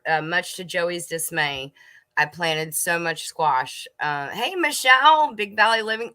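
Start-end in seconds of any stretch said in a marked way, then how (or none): 4.3-6.1 excited greeting. Real, not acted. Greeting a viewer who joined the stream.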